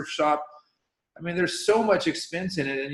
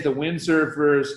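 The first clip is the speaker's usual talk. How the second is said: ordinary talk, nothing marked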